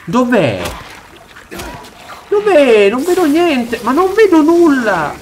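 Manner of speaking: high, loud frightened cries; panicked search. Real, not acted. Player hearing a monster but not seeing it, high panicked cries. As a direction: cry out searching for the unseen threat. Can't find monster in the dark.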